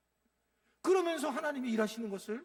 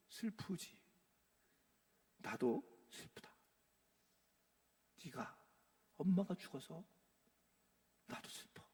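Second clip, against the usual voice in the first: comforting Jesus; 3 semitones lower, 8 dB quieter than usual. Retold or imitated. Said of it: "근데 예수님이".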